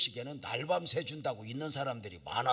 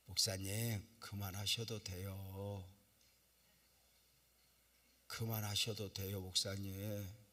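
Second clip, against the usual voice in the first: soft, slow pleading; gentle urging; 5 semitones lower, 4 dB quieter, slower than usual. Retold or imitated. Unbelieving onlookers gently urging the pastor to stop. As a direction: gently coax him to stop.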